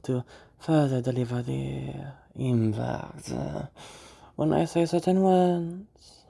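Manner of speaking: slow feminine character voice, holding words long; dragged-out feminine voice. Shown as a demonstration. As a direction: drag each key word over, with little pauses. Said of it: "The way that I'm speaking that type of voice".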